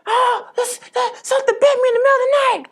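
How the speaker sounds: high, loud screech of alarm; panicked cry. Retold or imitated. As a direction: cry out as if just bitten in your sleep. Retells cousin's panicked cry when bitten.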